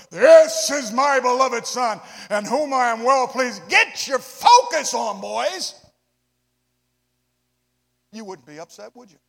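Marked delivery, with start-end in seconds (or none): 0.1-3.7 slow, booming voice from heaven; voice of God. Acted. Voice of God from cloud. 3.7-5.8 slow, very loud command; booming pulpit charge; preaching exhortation. Acted.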